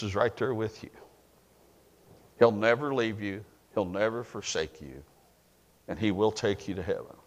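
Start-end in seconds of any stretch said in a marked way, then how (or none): none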